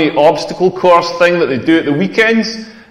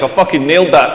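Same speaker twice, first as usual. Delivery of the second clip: usual speaking voice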